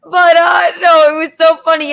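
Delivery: laughing. Real, not acted. Bursts out laughing mid-story.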